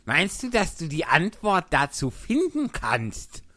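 loud and high, pushing the question; March Hare asking loudly. A narrator's reading. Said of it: "fuhr sie laut fort".

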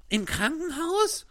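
shocked gasp. Acted. Pinocchio hears bad news.

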